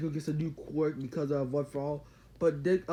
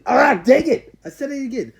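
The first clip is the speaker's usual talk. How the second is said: real frustration; 10 semitones higher, 13 dB louder than usual. Real, not acted. Annoyed at saying basically again.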